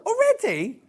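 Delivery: high, loud surprised question. Real, not acted. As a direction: sound surprised at the news. Surprised at the news.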